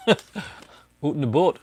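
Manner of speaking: slow, drawn-out joke Canadian "oot and aboot"; playful slow Canadian accent gag; mock Canadian accent. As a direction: ham up a Canadian accent, very slowly. Joking about Canada Day.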